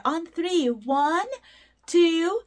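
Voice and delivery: ordinary talk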